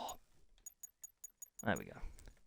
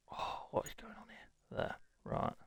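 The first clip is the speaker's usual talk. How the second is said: whisper; 13 dB quieter than usual. Real, not acted. Baffled by the game to himself.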